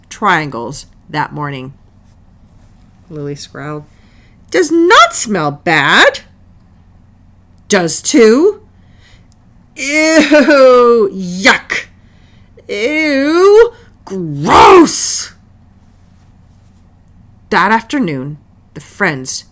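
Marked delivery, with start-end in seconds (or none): 4.5-8.7 loud, high bickering; childish quarrel. Acted. Lily and Selma bicker over smelly sandwiches, loud and high. 9.8-15.3 loud, high mock disgust; disgusted taunting. Girls taunt each other's lunch with loud, high disgust.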